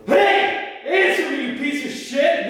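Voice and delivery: high, loud furious yell; screaming at Jeff to answer; angry shout. Acted. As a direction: bark at him to answer.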